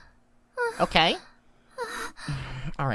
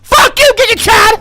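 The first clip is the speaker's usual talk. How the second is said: much higher and louder angry shouting; mock furious yell; 11 semitones higher, 12 dB louder, slower than usual. Streamer screams insults at a donor in mock rage. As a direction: blow up at him in a hammed-up rage.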